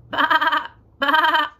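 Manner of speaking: loud bleating baa; sheep baa. Pretend. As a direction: bleat like a lamb. Sheep sound for child.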